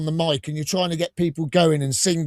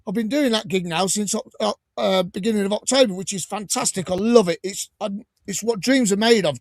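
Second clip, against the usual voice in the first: ordinary talk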